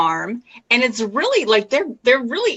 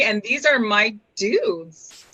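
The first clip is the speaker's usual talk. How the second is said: ordinary talk, nothing marked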